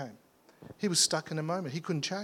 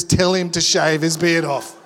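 slow, loud, pressing command; insistent prophetic prompting, drawn out; emphatic inner voice; 11 dB louder than usual. Retold or imitated. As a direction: hammer home the word given him.

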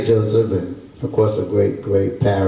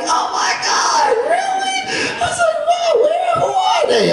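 very high, squealed disbelief; acted amazement. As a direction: replay wide-eyed shock in falsetto. Reacting to news of more visitors.

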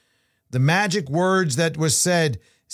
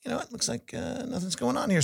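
inner thought voice; 8 semitones higher, 7 dB quieter than usual. Retold or imitated. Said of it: "And I'm like".

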